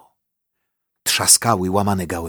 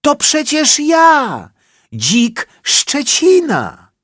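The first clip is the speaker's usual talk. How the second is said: very high, loud, slow boar voice; boar's voice; 6 dB louder, slower than usual. A narrator's reading. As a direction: announce yourself in a squeaky boar voice. Boar reveals himself.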